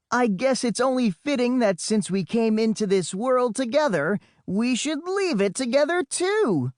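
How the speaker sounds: high, grand, dramatic farewell speech; waxing poetic. Acted. Boy makes a theatrical farewell to his twin, mock-grand. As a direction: deliver dying words like a poet. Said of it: "wax poetic with my dying words".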